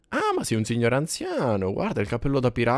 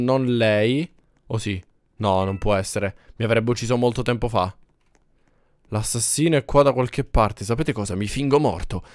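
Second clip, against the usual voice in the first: normal talking voice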